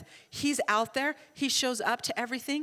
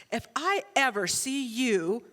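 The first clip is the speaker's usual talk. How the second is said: really serious; 3 dB louder than usual. Retold or imitated. Son warning mother on the phone, stern and deliberate. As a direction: warn sternly, stretching the opening words. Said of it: "like, really serious".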